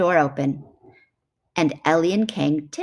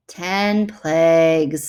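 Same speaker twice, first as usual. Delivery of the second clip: slow, louder weary correction; weary sigh; 6 dB louder, much slower than usual. Acted. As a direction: spell it out slowly, fed up. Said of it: "sighed Chimp".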